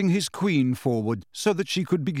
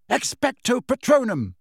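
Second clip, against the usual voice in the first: spell cry; 11 semitones higher than usual. Acted. Casting a spell at exam.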